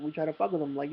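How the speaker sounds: ordinary delivery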